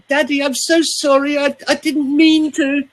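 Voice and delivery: put-on high, childlike plea to daddy; pleading small child. Coach imitating a child apologising to daddy in a high voice. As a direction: beg like a frightened little kid. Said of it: "when we're stressed as a small child".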